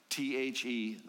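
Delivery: spelled letter by letter, T H E. Shown as a demonstration. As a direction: spell out "T H E" one letter at a time. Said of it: "the opening three-letter word".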